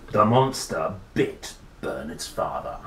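usual speaking voice

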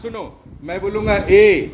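ordinary delivery

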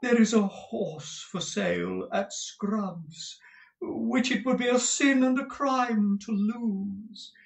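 high, slow, drawn-out rapture; affected, gushing husband. Acted. Husband coaxing his wife over a horse, affected high drawl. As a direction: drawl it grandly, swooping up high. Reads Mantalini's part in character.

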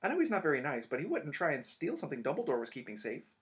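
earnest protest. Acted. Hermione insisting Snape wouldn't steal, earnest. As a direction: argue for the teacher.

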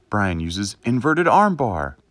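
slow, loud game announcer; Pokémon battle announcer. Pretend. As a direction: call the move like a Pokémon game. Pokémon battle narration parody.

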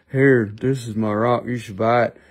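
deadpan it like a seller without personality; dull rival seller. Acted. Other seller pushing his rock, low and dreary. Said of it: "they're just like".

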